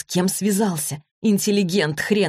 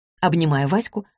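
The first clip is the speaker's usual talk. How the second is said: ordinary delivery, nothing marked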